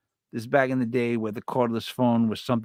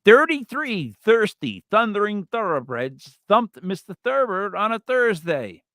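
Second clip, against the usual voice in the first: slow, careful, higher tongue twister; tongue twister demo; 7 semitones higher, 4 dB louder, slower than usual. Shown as a demonstration. Host demonstrating a tongue twister for his cohost, slow and careful. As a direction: spell out each th sound slowly. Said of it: "Come on, sir, you're next".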